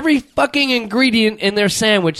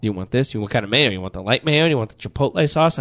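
lower, quieter mock server firing questions; low imitation of a server's questions; Subway server; 11 semitones lower, 4 dB quieter than usual. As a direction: rattle off the sauce options like a server. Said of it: "they will ask you".

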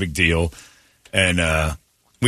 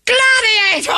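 very high, loud mock-drunk shout; shouted Gladiator; 5 dB louder, slower than usual. Host imitating a drunk actress blurting out the winner. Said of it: "she just shouted Gladiator at everyone".

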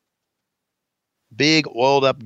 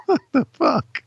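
laughing disbelief; 14 semitones higher than usual. Real, not acted. Laughing at the pay-per-view.

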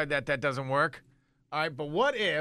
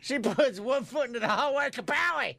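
high hammed-up action narration; mock action hero; 7 semitones higher than usual. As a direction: ham it up like an action movie. Mock gun scene.

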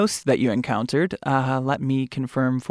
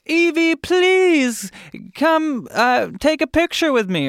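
fan's request; 12 semitones higher than usual. Retold or imitated. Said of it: "Or they say".

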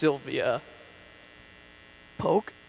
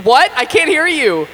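loud, high shout over noise; 11 semitones higher, 17 dB louder, slower than usual. Acted. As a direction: yell over the loud buzz.

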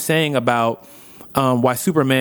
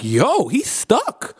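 slow amazed realization; 9 semitones higher, much slower than usual. Retold or imitated. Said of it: "'cause I'm like".

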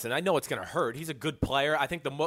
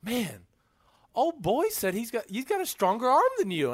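much higher, needling teammate voice; teammate's taunt; 9 semitones higher than usual. Retold or imitated. Teammate needling him mid-game, voice pitched way up. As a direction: tease him like a trash-talking teammate. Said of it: "he'd be like".

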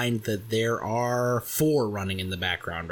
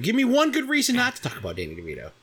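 fast, high, pushy dare; mock challenge; 11 semitones higher, much faster than usual. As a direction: demand it like a challenge.

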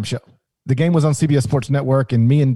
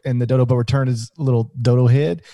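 ordinary talk

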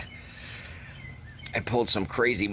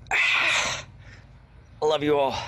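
slow farewell; 5 semitones higher, much slower than usual. Acted. Dramatic farewell.

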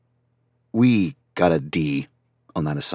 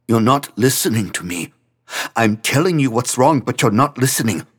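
loud, higher, upset accusation; tearful frustration, voice raised; angry outburst; 5 semitones higher, 7 dB louder than usual. Acted. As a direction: force him to hear you. Franz upset, accusing friend.

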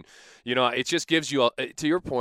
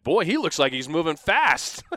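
mock amazed; 8 semitones higher, 8 dB louder than usual. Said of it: "and they said".

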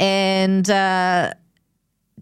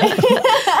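loud laugh; 4 dB louder than usual. Real, not acted. Big laugh.

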